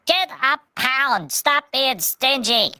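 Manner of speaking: Pudgy telling customers off, high and slow; Pudgy scolding. Retold or imitated. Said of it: "Pudgy's like".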